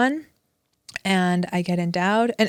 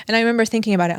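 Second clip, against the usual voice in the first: usual speaking voice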